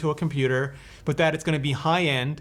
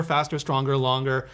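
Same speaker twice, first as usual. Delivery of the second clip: ordinary talk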